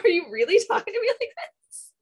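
mock indignant Heidi. Imitating Heidi scolding a rude contestant, hammed-up affront. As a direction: rattle it off in scandalized disbelief. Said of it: "Heidi was just like".

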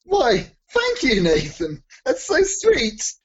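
high, slow, hammed-up gushing thanks. Vampire gushing thanks to his dinner guest, hammed-up and high. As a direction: gush your thanks like a flattered vampire.

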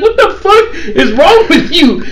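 high, slow incredulous outburst through laughter; hammed-up, drawn-out disbelief, laughing; laughing mock outrage. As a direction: act out an appalled boss while cracking up. Manager's retold outburst, teller laughing.

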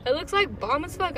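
ordinary talk, nothing marked

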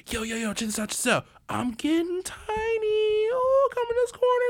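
tiny high voice of a man playing small; giddy shrinking act. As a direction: pretend you're shrinking and thrilled. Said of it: "He was like".

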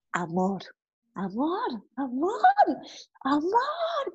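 calling amor, rising to a high cry; mild mock shout building up on each amor; imitating her cries of love; retold yelling. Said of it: "acabé gritando".

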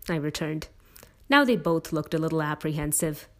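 ordinary delivery, nothing marked

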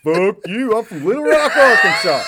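very high, loud caricature of a Southerner; southern accent. Retold or imitated. Retelling a comedy bit about accents, in a shouted southern accent. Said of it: "you know, the southern accent".

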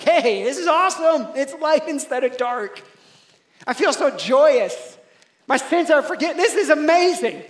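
high, loud, gushing excitement; hammed-up newcomer joy. Brand new believer just stepped into the kingdom, acted excitement. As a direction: play an overjoyed newcomer, shouting with delight. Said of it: "somebody who is brand new".

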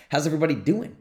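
ordinary talk, nothing marked

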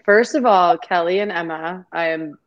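normal talking voice

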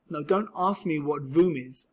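plain reading voice

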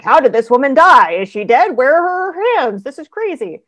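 reacting to a wild plot twist; baffled disbelief at a thriller, voice climbing; incredulous reader. Reading baffling thriller plots.

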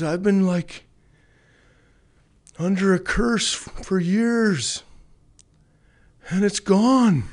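high, loud joyful declaration; excited exclaiming. Retold or imitated. Retold joyful testimony quote.

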